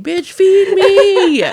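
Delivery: drawn-out, high demanding yell through a laugh; high, loud, slow comic holler; mock yelling cat. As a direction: wail it loudly like a hungry cat. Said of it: "he's yelling at her".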